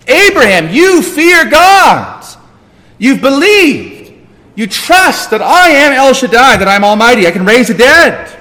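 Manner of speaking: loud, high, emphatic proclamation; preacher voicing God at full force; God assuring Abraham. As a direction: declare it forcefully as the Almighty. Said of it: "God speaks like this in the Bible to assure us".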